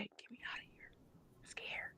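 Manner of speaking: soft frightened whisper, no voice. Retold or imitated. Mother imitating her daughter's scared whisper from the hospital bed. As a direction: breathe the plea in a scared whisper. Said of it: "You know, whispered".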